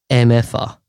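ordinary talk

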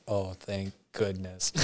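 very soft, slow relief; relieved sigh. Retold or imitated. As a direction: breathe it out in relief. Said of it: "relief when guys sat down".

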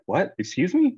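high-pitched, rising mock shock; retold disbelief. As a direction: act out a startled double take. Retold shock at intruder news.

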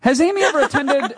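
breaking into laughter mid-question; reading while cracking up; laughing. Real, not acted.

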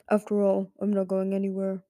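a tired, wary father dragon asking; a hint of an ancient stone-bound dragon; grave old dragon. Stone Mover voice again.

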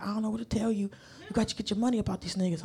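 soft hushed aside, quick on the last line; hushed whisper. Retold or imitated. Coworker on the phone giving advice, hushed and soft. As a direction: keep it down, low-key. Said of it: "I love the whisper that you've adopted".

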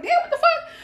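shocked high-pitched outburst; startled, voice jumping way up; surprised. Retold or imitated. Drunk surprise at friend backing in.

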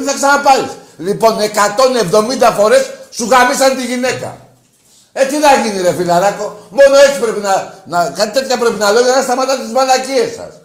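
high, loud, heated tirade; angry rant. Real, not acted. Host justifying his insult to the caller, angry rant. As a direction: rail loudly at the pestering callers.

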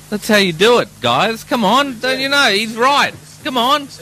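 high, eager coaxing; urging the band. Real, not acted. Presenter coaxing the squabbling band, high and eager.

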